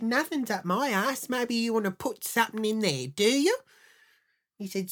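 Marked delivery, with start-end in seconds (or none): 0.0-3.6 high, pointed retort rising to a loud challenge; indignant taunt. Retold or imitated. Wife challenging her husband about his tapes, high and taunting. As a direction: goad him with a raised, sarcastic question.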